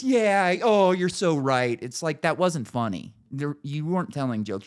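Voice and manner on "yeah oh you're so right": higher, louder slow fawning agreement; mock audience gushing, higher and louder; mock crowd cheer. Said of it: "everybody goes".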